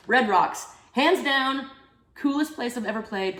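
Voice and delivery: loud, bright-eyed exclamation; excited. Real, not acted. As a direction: burst out with delight. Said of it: "enthusiastic answer".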